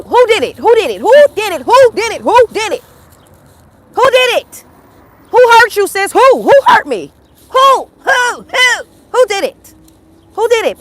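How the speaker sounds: high, loud, insistent yell; demanding shout. Real, not acted. Demanding who caused the trauma.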